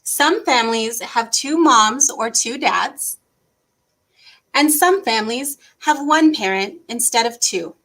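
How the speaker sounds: put-on gentle, even read-aloud voice for kids; reading to children. Teacher reading a family book to kids, gentle storytime voice. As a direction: read the picture book to little ones.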